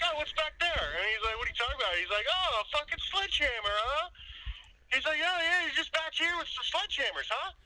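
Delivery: mocking the homeowner. Retelling the neighbor catching them, mock high sarcastic voice. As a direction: needle them like a suspicious neighbor. Voicing suspicious homeowner in retold story.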